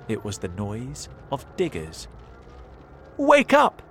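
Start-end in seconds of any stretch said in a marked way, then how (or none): 3.2-3.8 much higher, much louder shout; shouted alarm. A narrator's reading. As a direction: yell to wake the family. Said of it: "he shouted to his wife and children".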